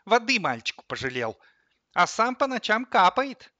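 loud, rising indignant reproach; fed-up outburst. A narrator's reading. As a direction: climb from scolding into a high accusation. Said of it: "не выдержала кофемолка". (возмущённо, всё выше и громче)

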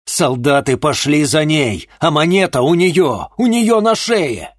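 loud, high, pleading urgency; urgent shout. Acted. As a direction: shout it, desperate to be heard. Shaking the dying man.

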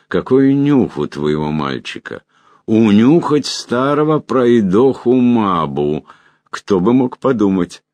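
put-on rattling old magician, delighted; amused old man, slowing on the jibe; quavering old voice. A narrator's reading. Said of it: "дребезжащий голос великого магистра". (дребезжащий голос довольного старика)

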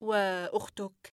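soft, slow, quiet question; doctor calmly interrupts. Acted. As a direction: drop it in calmly. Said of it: "قائلًا في هدوء".

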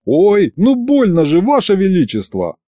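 mock-hurt high voice; pained protest. A narrator's reading. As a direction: complain at being hit. Complains after a slap, acted.

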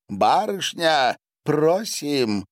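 high, slow sing-out invitation; inviting call. A narrator's reading. Bath attendant summons the young lady, calling invitingly. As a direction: draw it out invitingly, pitch up.

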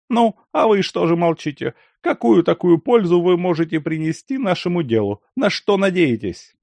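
scornful questioning. A narrator's reading. Lyakhovsky interrogates.

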